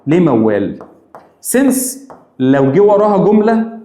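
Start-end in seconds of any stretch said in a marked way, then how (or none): 1.6-2.0 emphatic high since. Shown as a demonstration. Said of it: "Since دي موال".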